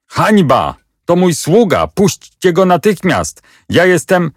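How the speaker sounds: wysoko, z oburzeniem, wołając do wartownika; gniewny okrzyk. A narrator's reading. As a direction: krzyk urażonego króla. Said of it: "Nieprzytomny z gniewu przebiegł przez plac i zawołał". (oburzony król woła do wartownika, wysoko i gniewnie)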